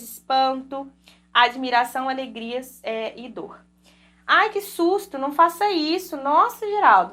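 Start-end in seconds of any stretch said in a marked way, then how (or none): 4.2-7.1 high, startled exclamations, a little louder; exclamatory surprise. Shown as a demonstration. Teacher to class, demonstrating the exclamation tune with mock surprise. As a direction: act out a mild fright, then surprise at meeting someone. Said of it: "nessa entonação marcada pela exclamativa".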